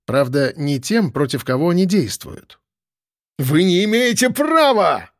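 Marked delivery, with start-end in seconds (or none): 3.4-5.1 high, drawn-out outraged cry; defiant protest. A narrator's reading. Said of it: "не сдавался Ананьин".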